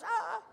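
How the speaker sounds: mock scream. Wife on her phone in the car, mock scream.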